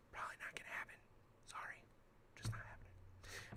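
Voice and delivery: hushed whispered aside. Real, not acted. Streamer whispers aside to viewers, hushed. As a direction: whisper it like a secret.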